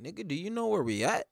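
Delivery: soft, measured, halting questioning; lightly acted challenge. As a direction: play a quietly confident fighter. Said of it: "Igor's like".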